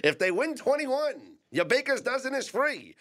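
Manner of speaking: high crier voice through laughter; laughing old-time proclamation; town crier. Pretend. Continues crier bit laughing.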